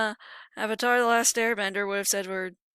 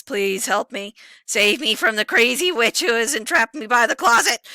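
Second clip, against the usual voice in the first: louder hammed-up begging; mock plea for help; 9 dB louder than usual. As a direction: beg to be saved, half joking.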